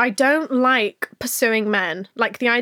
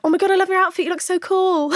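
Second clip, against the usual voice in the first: high gushing voice; 7 semitones higher than usual. Shown as a demonstration. Showing how not to compliment a girl's outfit, high and gushing. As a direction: gush like an excited fan. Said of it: "Instead of".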